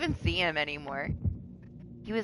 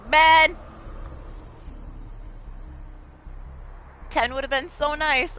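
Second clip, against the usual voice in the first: frustrated exclamation; 10 semitones higher, 3 dB louder, slower than usual. Retold or imitated. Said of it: "and I'm like".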